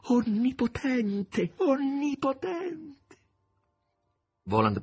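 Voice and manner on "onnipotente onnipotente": much higher and slow, in rapture; enraptured. Acted. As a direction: breathe it out in awe. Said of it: "aggiunse rapita".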